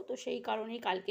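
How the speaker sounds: ordinary delivery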